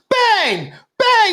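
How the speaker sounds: high, yelped "bang, bang" like a cartoon gun; mock gunshots. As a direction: fire off two silly high bangs. Retelling Hook movie gunshots.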